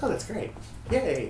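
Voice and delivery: high bright cheer; mock cheerful yay. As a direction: chirp it like a thrilled fan. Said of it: "So happy someone's using my brush".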